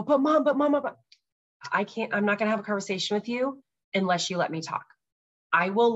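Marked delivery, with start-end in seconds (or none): 0.0-1.0 imitating daughter interrupting. Mom mimics daughter interrupting her, high and insistent. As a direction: mimic a child cutting in. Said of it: "she starts to go".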